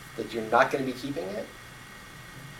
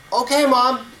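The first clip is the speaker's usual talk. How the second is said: put-on high, bright-eyed child's "okay"; six-year-old boy answering his mother, high and eager; little boy voice; 10 semitones higher, 5 dB louder, slower than usual.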